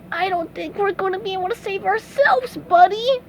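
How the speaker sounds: high, strained, short of breath; failing high cartoon voice; gasping. A narrator's reading. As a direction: gasp it out to a pal.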